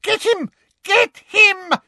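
shrieking, very high command; screaming. A narrator's reading. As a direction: scream the order. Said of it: "screamed Umbridge".

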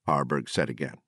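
ordinary delivery

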